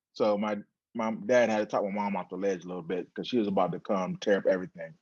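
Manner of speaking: usual speaking voice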